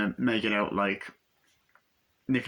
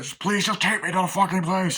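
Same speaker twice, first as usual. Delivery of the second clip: higher, urgent begging; acted desperate plea; 8 semitones higher, 3 dB louder than usual. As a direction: beg the driver for a lift, exasperated. Acting out desperate plea to driver.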